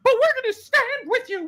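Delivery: very high, mock-heroic pledge; hammed-up solidarity in a squeaky-high register; mocking liberal. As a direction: leap up high to mock the empty promise. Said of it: "All the libtards are like this".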